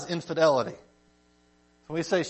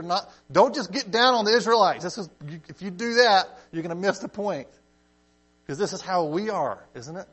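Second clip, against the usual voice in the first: usual speaking voice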